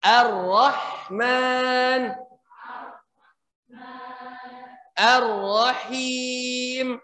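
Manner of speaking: slow Ar-Rahman with trilled R; drawn-out Ar-Rahman; Quran recitation. Shown as a demonstration. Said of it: "Huruf H-nya dialirkan".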